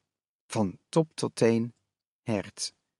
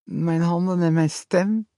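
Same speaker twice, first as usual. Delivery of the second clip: frightened lisping mother; 5 semitones higher than usual. A narrator's reading. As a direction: beg him to tell her the truth. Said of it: "zei ze zacht".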